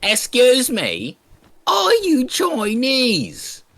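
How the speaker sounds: very high, slow mock old-lady voice. Old lady on the bus questioning two siblings, high old-lady impression. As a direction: stretch it out like a prying old woman. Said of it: "This old lady walks up to us".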